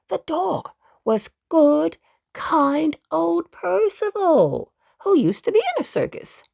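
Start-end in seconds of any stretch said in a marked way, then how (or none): none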